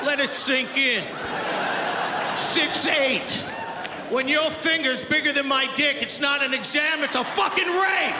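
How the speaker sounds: high-pitched indignant rant; comic outrage. Acted. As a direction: build to an appalled shout at the end. Comic outrage over doctor's exam.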